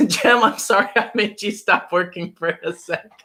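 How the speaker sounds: high, giggly apology; laughing apology. Real, not acted. Streamer to Jem who stopped working, laughing apology.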